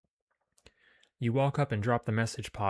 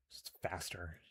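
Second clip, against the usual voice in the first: muttered under the breath, much softer; quiet mutter; 12 dB quieter than usual. Real, not acted. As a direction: mumble it to yourself. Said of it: "It's faster and I'm annoyed".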